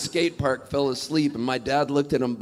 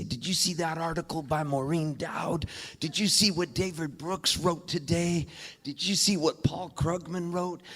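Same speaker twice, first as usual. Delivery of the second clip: a hint of an eager mom on the phone, softer; higher, softer quoted voice, lightly put on; lightly voiced mom; 3 semitones higher, 5 dB quieter than usual. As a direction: lightly imitate a mom checking in. Mom calling him about articles.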